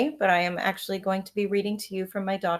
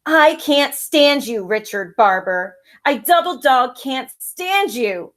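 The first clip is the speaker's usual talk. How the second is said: loud, slow angry outburst; screamed; 4 semitones higher, 10 dB louder, slower than usual. Acted. Sister screaming at her brother before running inside, loud. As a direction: shout it at him. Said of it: "I screamed".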